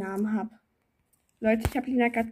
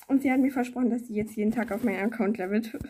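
normal talking voice

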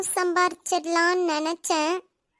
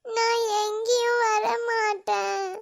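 tearful refusal, voice higher and breaking; crying; 3 semitones higher, slower than usual. Acted. Girl refusing to go with him, crying. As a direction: refuse through tears. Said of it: "நீ அழாத".

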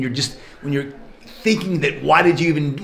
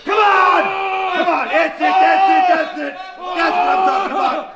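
shouting coach; 10 semitones higher, slower than usual. Real, not acted. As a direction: drive them hard with yells. Trainer yelling reps and encouragement.

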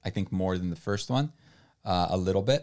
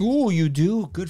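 excited; 10 semitones higher, 6 dB louder, slower than usual. Real, not acted. Teasing cheer at a DNF.

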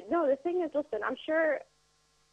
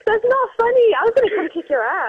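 much higher, much louder outraged yell; half-laughing yell; 10 semitones higher, 15 dB louder than usual. Real, not acted. Pranked victim yelling at the radio host, loud and high.

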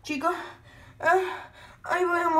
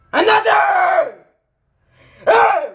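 loud, higher angry shout; angry yell; 5 semitones higher, 9 dB louder, slower than usual. Pretend. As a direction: yell him away. Angry yelling at character. (grito enojado)

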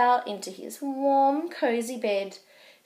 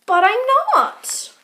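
much higher, loud squeak; squeaky protest; 11 semitones higher, 10 dB louder than usual. Acted. Baby Owl protesting to Mum, high loud squeal.

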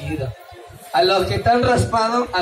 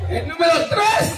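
announcing; 6 semitones higher than usual. Real, not acted. Announcing contestant.

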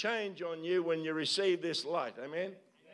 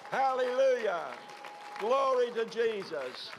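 higher, lifted cries of praise to Jesus; worship praise; 5 semitones higher than usual. Acted. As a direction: pour out praise, calling upward.

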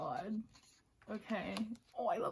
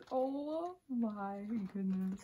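long drawn-out awe; awed; much slower than usual. Real, not acted. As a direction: stretch every word out in amazement. Awed at first photo book pages.

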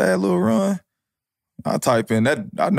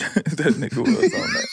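laughing; 7 semitones higher than usual. Real, not acted.

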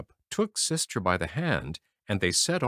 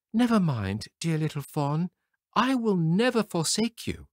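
put-on lightly higher soothing girl; tender comfort; 8 semitones higher than usual. A narrator's reading. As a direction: reassure the little fawn gently. Sister comforts fawn.

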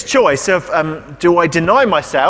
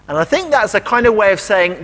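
ordinary talk

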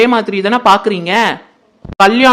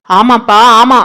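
loud angry retort; 7 dB louder, slower than usual. Acted. Aunt retorts loudly in quarrel.

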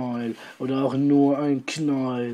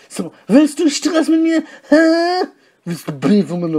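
picking a fight; 9 dB louder than usual. Acted. Dieter picks a fight.

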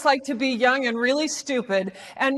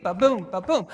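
"ba-boom" with light ba, heavy boom; cantering rhythm; 6 semitones lower than usual. Shown as a demonstration. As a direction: lower "ba-boom", bouncing onto each boom. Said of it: "like a horse cantering along".

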